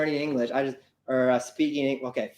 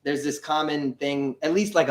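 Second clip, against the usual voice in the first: ordinary talk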